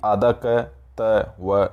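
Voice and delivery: आधा क, त, व spelled out slowly, sound by sound; each letter of आधा क, त, व named apart, slowly; slow spelling out. Shown as a demonstration. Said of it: "देखिए, आधा क है". (अक्षर अलग-अलग धीरे-धीरे बोलना)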